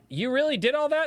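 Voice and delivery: high, incredulous question; retold wide-eyed doubt; amazed disbelief. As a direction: ask it like you can't believe him.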